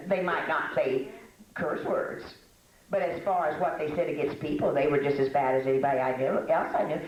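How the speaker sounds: usual speaking voice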